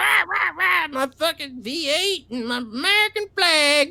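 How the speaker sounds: high, mock-rowdy American; hammed-up brash American bragging; loud American. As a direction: caricature of loud patriotic Americans. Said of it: "Americans are the ones that are all loud".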